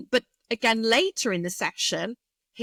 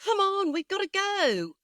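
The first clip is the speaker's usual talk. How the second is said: high-pitched, hurried-along parent's call; slightly impatient; 11 semitones higher than usual. Acted. Parent hurrying child to school, slightly impatient. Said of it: "in that sort of slightly impatient voice".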